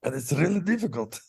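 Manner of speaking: a hint of a nerdy Russian accent; lightly Russian, strained; Sergei voice. Shown as a demonstration. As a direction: slip into the geeky Russian voice. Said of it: "speak like that all the time".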